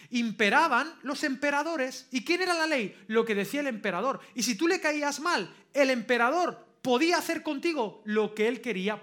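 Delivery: loud, driving sermon delivery; forceful preaching. Real, not acted. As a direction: drive each point home to the congregation. Predica con toda su fuerza. (predicación enérgica y potente)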